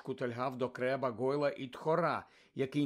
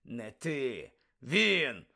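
creaky; much slower than usual. Acted. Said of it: "проскрипів Муді".

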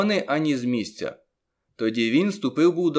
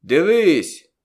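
sharp rise in a loud, high call; loud shout; 5 semitones higher, 8 dB louder than usual. A narrator's reading. Conjurer commanding the crowd to look, loud shout. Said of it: "Тоді як гукнув".

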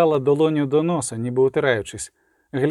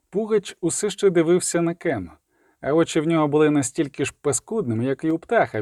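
usual speaking voice

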